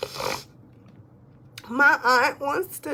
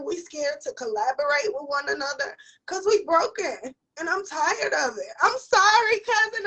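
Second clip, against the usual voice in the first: crying. Real, not acted. Speaking to viewers about broken people, crying. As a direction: voice breaking in tears, rising. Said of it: "I don't want you crying".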